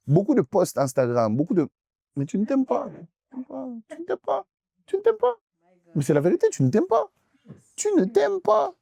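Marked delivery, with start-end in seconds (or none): 2.2-8.7 very high, pressing repeated jab; high insistent repetition. Real, not acted. Preacher hammers the young congregation, very high insistence. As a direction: climb higher with each repeat.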